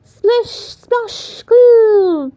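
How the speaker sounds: high, bouncy splish splash; splashy sound effects. Acted. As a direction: play out the penguins splashing. Penguins splashing in story.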